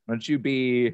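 ordinary talk

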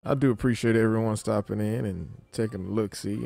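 ordinary delivery

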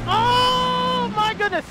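high, slow, stretched-out shriek of fright; terrified scream. Real, not acted. Gamer falling off a ledge, shrieking high and slow. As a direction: wail it as you start to fall.

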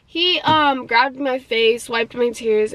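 normal talking voice

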